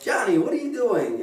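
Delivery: retold yell, swooping up in pitch; mock yell. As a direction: call it out high, only half-yelled. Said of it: "and she yells".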